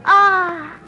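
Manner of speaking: ordinary delivery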